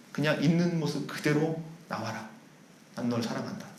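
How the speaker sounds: soft, low voice of Jesus inviting the sinner to come as they are; tender Jesus voice. Acted. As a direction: fade down to a loving hush. Jesus speaking love to sinner.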